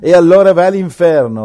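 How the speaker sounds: loud, high, hammed-up condemnation; mock damnation. Mock evangelist damns the man who won't enter, loud. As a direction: fling it at him like a curse.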